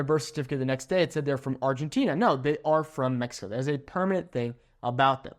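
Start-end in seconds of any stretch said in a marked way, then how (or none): none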